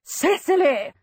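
high commanding shout of a name; fierce shout. A narrator's reading. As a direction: shout her name in fury. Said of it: "the fierce voice exhorted".

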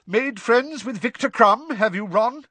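high, loud, self-important; puffed-up, approving inquiry; pompous busybody. Acted. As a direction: greet with officious delight. Pompous Percy greets his brother.

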